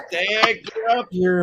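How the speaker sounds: imitating a daughter calling, high and drawn out; high, stretched-out summons. Daughter calls dad upstairs, imitated calling out.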